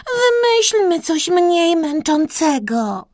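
high, whiny plea; worn-out little character pleading; weary moan. A narrator's reading. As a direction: whine it high, tired of the game. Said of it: "jęknęła w pewnej chwili Kreska".

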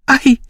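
sharp high yelp; high startled cry. A narrator's reading. Lady of the house cries out at the dog's yelp, high cry. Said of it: "krzyknęła pani".

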